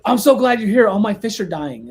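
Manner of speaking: hammed-up frantic greeting; frantic client. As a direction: imitate a frantic customer bursting out. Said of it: "she opens the door all frantic".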